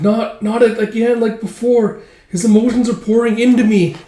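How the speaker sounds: high, loud, overwhelmed voice; squeaky, dramatic dismay; distressed elf. Acted. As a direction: cry out in acted alarm.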